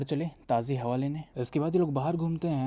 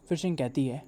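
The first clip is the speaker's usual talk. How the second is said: plain reading voice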